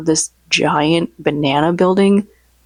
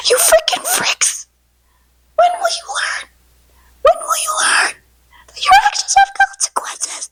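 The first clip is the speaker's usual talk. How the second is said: high whispered shout; a strained, high, whispered rant; impression of Fricks. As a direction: scream it in a high whisper so the neighbors won't hear. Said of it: "really good impression of him".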